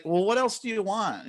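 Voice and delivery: high, fed-up question; exasperated. Retold or imitated. Streamer retells pushing back at support staff, fed up. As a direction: plead in mock frustration. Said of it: "So it's like".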